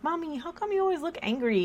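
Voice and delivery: daughter's voice. Retold or imitated. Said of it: "she'd be like".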